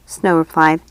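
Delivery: ordinary delivery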